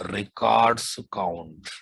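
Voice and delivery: ordinary talk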